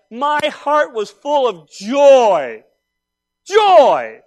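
joyful cry. Real, not acted. Pastor telling his church his heart was full, shouting out Joy. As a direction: let the joy burst out. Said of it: "proclaims his joy".

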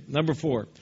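ordinary talk

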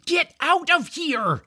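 high-pitched urgent yell. A narrator's reading. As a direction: yell to send someone fleeing. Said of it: "George yelled".

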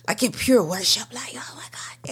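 whispered, breathless awe; awed whisper. Retold or imitated. Recalling sobbing in bed to listeners, awed whisper. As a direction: breathe it out like a prayer. Retelling her worship moment.

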